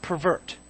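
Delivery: Pervert as a verb, per-VERT; stress on second syllable. Shown as a demonstration. Preacher corrects the congregation, Pervert stressed on VERT. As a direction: Pervert with the weight on the last syllable. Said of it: "put the emphasis on the right syllable".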